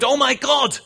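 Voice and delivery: high, loud panicked shriek; terrified scream. Acted. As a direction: scream it as the bear attacks. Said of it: "the atheist screamed".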